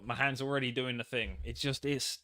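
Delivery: ordinary talk, nothing marked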